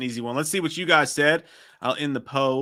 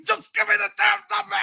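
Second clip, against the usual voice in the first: mock shout. Streamer quoting the movie line, shouted high. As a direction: yell it in frustration. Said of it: "I said".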